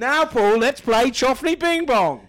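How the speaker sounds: slow, loud showman announcement; game show host. Pretend.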